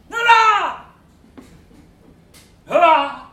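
put-on loud high drunken hurrah; drunken cheer. Sir John Paternoster cheering his drinking companions, loud and high. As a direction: bellow it like a toasting drunk.

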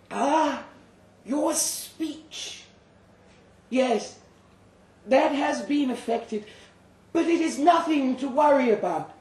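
a calm creature doctor at the bedside; doctor's voice. Acted. Doctor voiced over narration.

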